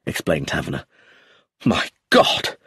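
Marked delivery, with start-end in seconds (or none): 1.6-2.6 higher, breath-caught outburst; shocked exclamation. A narrator's reading. Roger learns his father was poisoned, shocked exclamation. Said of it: "exclaimed Roger".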